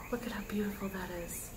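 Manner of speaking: hushed whisper of wonder; awed whisper. Real, not acted. Reader showing viewers the cards, hushed whisper. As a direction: breathe it out in awe. Awed over the card spread.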